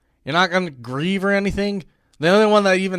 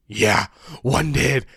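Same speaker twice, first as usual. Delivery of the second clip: low, slow general voice; deep deadpan impression; mock general; 3 semitones lower, slower than usual. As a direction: play a grave, unhurried commander. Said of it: "And he's like".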